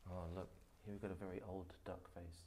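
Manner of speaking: usual speaking voice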